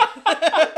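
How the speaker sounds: high loud cackle; burst of laughter. Real, not acted. Laughing at the absurd airport.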